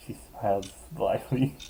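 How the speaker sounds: ordinary delivery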